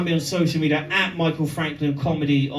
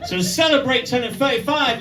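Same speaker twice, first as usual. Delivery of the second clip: rising, louder build to a shouted reveal; building excitement; 7 semitones higher, 4 dB louder, faster than usual. Real, not acted. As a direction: pump the crowd up to the punchline.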